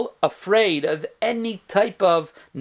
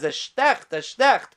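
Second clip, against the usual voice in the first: mock cry of pain; 5 semitones higher, slower than usual. Old rabbi crying out as the sock goes on, mild mock yell. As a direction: imitate an old rabbi's pained cry, pitched up. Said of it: "gave a scream, roared".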